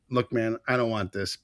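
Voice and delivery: soft, low, calm de-escalation; a calm guy quietly defusing a fight. Retold or imitated. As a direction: talk him down quietly. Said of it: "all calm and goes".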